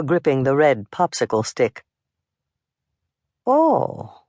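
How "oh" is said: high, falling "oh" of surprise; surprised. A narrator's reading. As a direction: react to an unexpected visitor. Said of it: "regarded the stranger in surprise".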